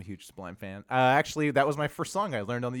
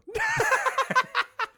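very high-pitched laughing fit; laughter. Real, not acted. Storyteller breaks up at his punchline, high laughter.

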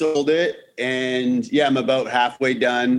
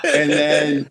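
usual speaking voice

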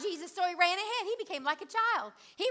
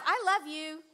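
ordinary talk

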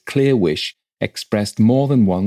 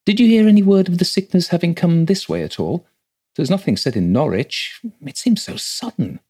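troubled disbelief; 10 semitones higher than usual. A narrator's reading. Rector questions housekeeper about death.

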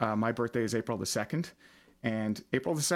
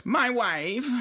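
high, swooping catchphrase impression; Borat; 10 semitones higher than usual. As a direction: slip into a comic foreign voice for the catchphrase. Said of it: "okay, I'll stop that now".

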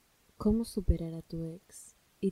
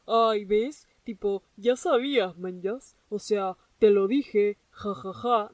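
mocking told-you-so; 6 dB louder, slower than usual. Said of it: "no te digo esto para decirte".

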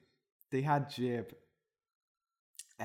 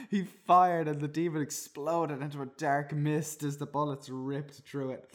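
ordinary talk, nothing marked

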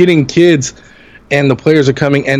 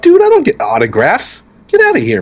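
brushing him off; 11 semitones higher than usual. Retold or imitated. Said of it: "I'm like".

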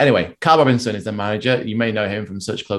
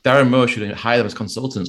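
normal talking voice

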